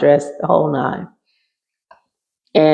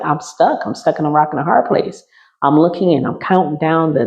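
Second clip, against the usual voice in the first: usual speaking voice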